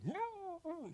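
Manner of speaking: high, soft drawn-out wail; thin mock cry of a smothered victim; imitated struggling cry. Dad being suffocated with a pillow, soft struggling wail. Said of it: "we hear him struggling".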